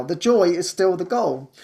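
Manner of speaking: ordinary delivery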